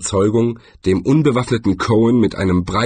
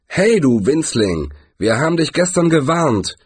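growled warning, raised pitch; threatening growl; 6 semitones higher than usual. A narrator's reading. Gang leader threatening the dwarf, growled. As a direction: growl it like a bully. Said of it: "knurrte er".

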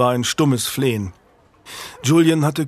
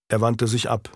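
usual speaking voice

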